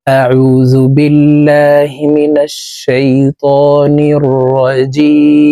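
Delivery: slow melodic tajweed chant; Quran recitation. Shown as a demonstration. Teacher opening the lesson with measured tajweed chant. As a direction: draw out each word in recitation.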